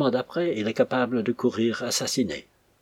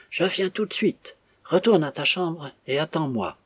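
distinct, commanding; 7 semitones higher than usual. A narrator's reading. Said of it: "dit distinctement".

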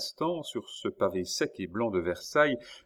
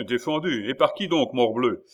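raised voice, higher and louder; indignant challenge; 4 semitones higher, 7 dB louder than usual. A narrator's reading. Prince challenging the officer's ban, indignant. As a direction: indignantly demand who gave the order.

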